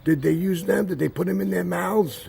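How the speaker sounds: high-pitched mock outraged rant; hammed-up shrieky interrogation, climbing high; imitated yelling. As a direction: mimic a distraught girl yelling in protest. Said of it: "she started yelling at my grandmother".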